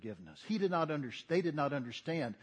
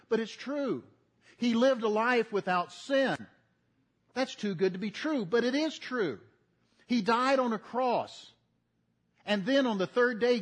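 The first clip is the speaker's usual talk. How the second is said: higher, louder building sermon cry; preaching at full force; 7 semitones higher, 5 dB louder than usual. Acted. Pastor proclaiming the gospel to congregation, fervent preaching. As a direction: rise into a fervent pulpit climax.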